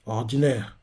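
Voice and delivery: a clipped dismissive one-word judgment; curt dismissal. Retold or imitated. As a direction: drop it coldly, one word, end of topic. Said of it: "Et Rishi fait".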